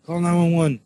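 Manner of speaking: urgent retold shout for help; retold scream. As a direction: call for help in a half-shout. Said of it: "I started screaming".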